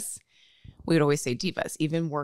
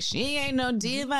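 high-pitched quote of a friend; War; 8 semitones higher than usual. Retold or imitated. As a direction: lift it high, imitating a friend's catchphrase. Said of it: "but he was like".

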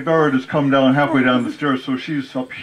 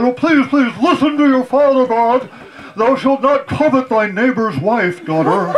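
pleading father; 12 semitones higher than usual. Retold or imitated. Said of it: "so my father said".

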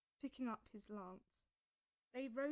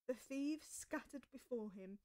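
ordinary delivery

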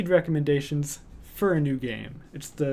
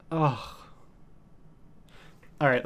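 ordinary talk, nothing marked

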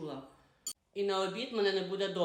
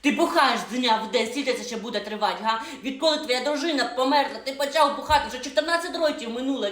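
angry scolding; 4 semitones higher, 7 dB louder than usual. Acted. Wife scolds drunk husband.

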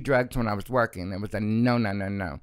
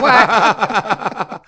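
laughing mock-shocked what, higher and louder; indignant what; 9 semitones higher, 8 dB louder than usual. Retold shock at the trick's lowball offer, high laughing what.

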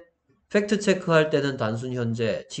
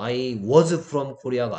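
slow, word-by-word reading of the wrong sentence; very slow wrong example; much slower than usual. Shown as a demonstration. Teacher reading a wrong example to the class, very slow. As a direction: read out the wrong version deliberately slowly. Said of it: "아니고요".

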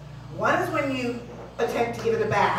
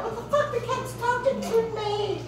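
startled; 10 semitones higher, 4 dB quieter than usual. Retold or imitated. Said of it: "You're like".

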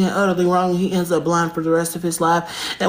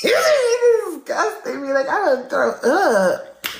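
high laughing shout, slower; disgusted shriek; 7 semitones higher, slower than usual. Real, not acted. Streamer reacting to the live host, high laughing disgusted shriek. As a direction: shriek it out through laughter, revolted.

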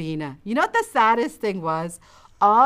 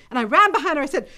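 usual speaking voice

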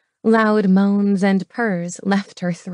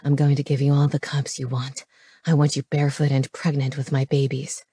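low-pitched intimate male lover; low, possessive promise; breathed by ear; 6 semitones lower than usual. Acted. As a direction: voice the man low, close to her ear. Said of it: "he breathed by her ear".

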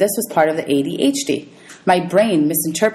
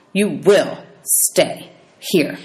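very slow, menacing teacher command; cold command; much slower than usual. Acted. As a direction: drag each word out like a threat. Teacher commands Grover coldly.

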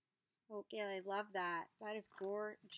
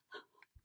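breathy unvoiced gasp; quick gasp of delight; soft gasp; 11 dB quieter than usual. Real, not acted. Seeing the spool, soft gasp.

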